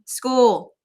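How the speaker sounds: loud happy cheer; excited cheer. Acted. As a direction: burst out with joy. First day, ran to school.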